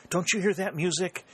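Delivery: high, eager exclaiming; narrated rush of eagerness; excited cry. A narrator's reading. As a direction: exclaim with battle fever. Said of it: "he cried".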